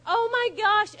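loud, high panicked outburst; acted-out freak-out, pitched high. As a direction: blurt it out in rising panic. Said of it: "And I'm freaking out".

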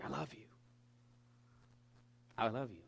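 soft, low-key murmured declaration; tender near-whisper. Retold or imitated. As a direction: retell a father whispering to his newborn. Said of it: "but I'm whispering".

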